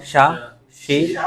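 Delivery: ordinary talk, nothing marked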